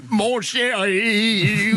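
slow, cartoon smurf grandpa voice; grand Schtroumpf voice. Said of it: "mon grand-père a la voix du grand Schtroumpf".

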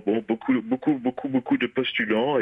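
lightly imitating Nikos, high on the first words; a rough take on Nikos selling a flat; Nikos impression. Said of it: "parce que là, il a imité Nikos".

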